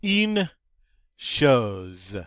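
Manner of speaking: teacher breaks the word apart; split into parts. Shown as a demonstration. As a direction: say Une, pause, then drop to chose. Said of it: "teacher splits the word".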